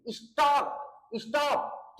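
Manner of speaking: Stop said as is-TOP, weight on the end; stress on last syllable. Shown as a demonstration. Teacher demonstrates doubled-consonant words, stop stressed on its last syllable. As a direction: lean on the final part of stop. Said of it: "अंतिम वाले syllable पे जोर पड़ेगा".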